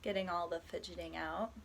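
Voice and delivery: calm, unhurried body scan guidance with long pauses; guided meditation. Acted. Guiding a listener through a body scan, soft meditation voice. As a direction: ease the listener gently from one body part to the next.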